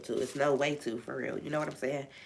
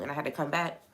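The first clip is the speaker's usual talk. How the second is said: ordinary talk, nothing marked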